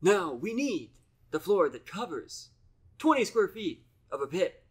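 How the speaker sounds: commanding starship captain, hammed up; Star Trek captain. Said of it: "No, Captain".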